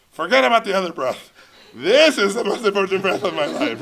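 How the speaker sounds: loud, high, urgent rush; frantic invocation. Retold or imitated. Pitcher rallying himself as time runs out, frantic and loud. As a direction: call on the ancestors in mock desperation.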